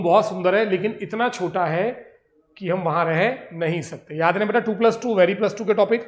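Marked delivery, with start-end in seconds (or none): none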